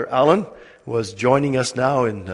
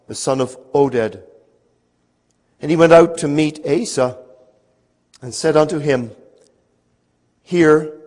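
louder, measured Bible reading; scripture reading; 3 semitones higher, 4 dB louder than usual. Acted. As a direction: read it out solemnly with pauses. Reads scripture aloud to congregation.

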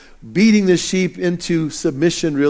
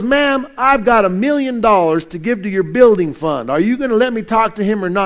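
put-on higher, impatient Texas accent, quickening at the end; 6 semitones higher than usual. Texan caller offering a million to the secretary, impatient Texas accent. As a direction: push the secretary in a Texan twang. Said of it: "my best Texas accent".

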